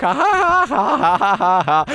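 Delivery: laughing in triumph; gloating laugh. Real, not acted. Laughing at his friend stuck in the trap, gloating. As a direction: cackle over the successful prank.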